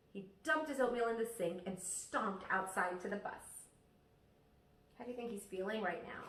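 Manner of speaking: ordinary delivery, nothing marked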